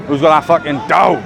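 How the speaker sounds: normal talking voice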